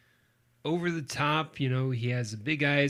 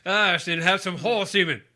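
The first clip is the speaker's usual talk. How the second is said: loud, higher sketch-comedy actor impression; Sean Connery; 5 semitones higher, 8 dB louder than usual. Co-host piles onto the SNL bit, Sean Connery impression. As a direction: blurt it as a parody film star, amused. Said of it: "Thank you, Daryl Hammond".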